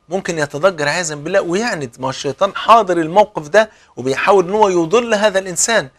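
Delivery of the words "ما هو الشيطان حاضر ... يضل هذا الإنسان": ordinary talk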